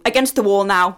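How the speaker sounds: stern command. Acted. Teacher orders them to the wall.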